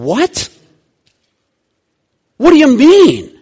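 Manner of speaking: put-on the older son spits out his outrage at the feast; indignant older brother. Older son's indignant outburst voiced.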